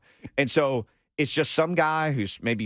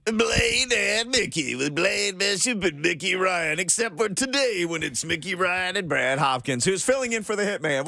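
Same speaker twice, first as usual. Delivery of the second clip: big announcer voice; 5 semitones higher than usual. Pretend. Host mimicking the station announcer guy, booming and slow. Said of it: "Whose voice are you doing right there?".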